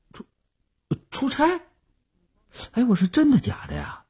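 high-pitched, incredulous question; startled disbelief. A narrator's reading. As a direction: react as if badly caught out. Startled by her refusal.

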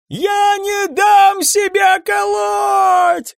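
high, very long-drawn shriek; screaming. A narrator's reading. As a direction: scream the refusal with all your might. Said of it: "кричал Сайрус". (истошный протяжный вопль)

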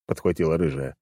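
ordinary delivery, nothing marked

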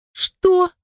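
stunned high cry; shocked. A narrator's reading. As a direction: gasp it out in disbelief. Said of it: "опешил я".

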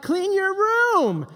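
high, slow nagging mom; mock nagging mom. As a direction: drag it out like a fed-up mom. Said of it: "Your mom's like".

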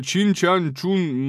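high, slow mocking sing-song; mock Chinese gibberish. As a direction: ham up fake Chinese.

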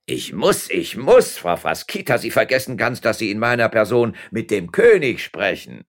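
lightly voiced pompous mayor; self-important mayor.

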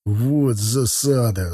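slow, drawn-out exclamation; mild acted cry of annoyance; shouting. A narrator's reading. Sergei curses the trouble, drawn-out mild shout. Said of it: "закричал Сергей".

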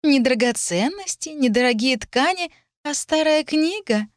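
high, mocking girl's voice, rising in disbelief; disdainful. A narrator's reading. Lilith belittles the heroine's inheritance, disdainfully. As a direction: sneer at it item by item, high. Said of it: "пренебрежительно фыркнула Лилит".

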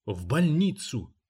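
slow, mildly strained gasp of pain; lightly acted agonized plea; squeezed out with effort. A narrator's reading. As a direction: force it out slowly, as if doubled up. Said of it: "еле выдавил он".

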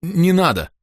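high, hurried "don't"; hasty protest. A narrator's reading. Father hastily stops his daughter shouting, urgent protest. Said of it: "поспешно остановил я дочь".

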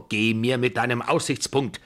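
put-on cross old matriarch's dismissal; annoyed. A narrator's reading. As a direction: brush him off crossly. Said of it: "wehrte die Babuschka ärgerlich ab".

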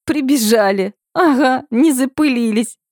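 high, through laughter; laughing. A narrator's reading. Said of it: "Сквозь смех выдавливают слова".

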